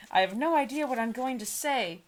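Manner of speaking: ordinary talk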